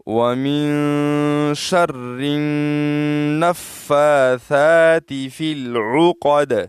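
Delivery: very slow, drawn-out tajweed recitation; slow melodic Quran recitation with heavy قاف; Quranic recitation. Shown as a demonstration. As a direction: chant each word with full tajweed, stretched long. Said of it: "تو کس طرح ہم اس کو پڑھیں گے؟".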